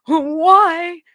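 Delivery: hammed-up exasperated yell at Crystal; mock exasperated yell. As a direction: play the fed-up friend yelling at her. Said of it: "yell Crystal and be like".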